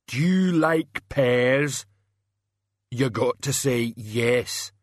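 slow, ponderous simpleton; heavy, dim-witted brute voice; Banjo. A narrator's reading. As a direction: insist slowly, stretching each word. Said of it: "Banjo rumbled".

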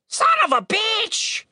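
very high, drawn-out screech; screaming raven. A narrator's reading. Wounded raven swears at Tim, screaming. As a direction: curse like an injured bird. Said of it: "it screamed".

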